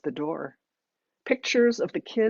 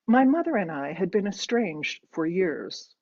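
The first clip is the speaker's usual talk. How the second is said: plain reading voice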